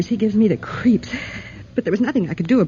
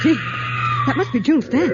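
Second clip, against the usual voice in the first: alarmed cry; 6 semitones higher than usual. Acted. Hearing screams from the cages.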